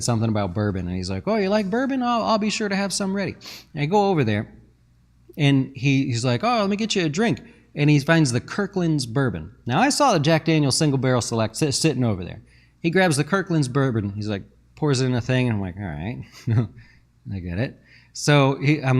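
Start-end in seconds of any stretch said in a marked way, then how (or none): 1.3-3.3 higher, eager host voice, lightly played; chirpy neighbor. 6.4-7.4 higher, eager host voice, lightly played; chirpy neighbor. 15.8-17.8 quieter, laughing it off; a dry laugh through the words; wry chuckle. Retold or imitated. Guest realizing the host poured cheap bourbon, quiet laugh.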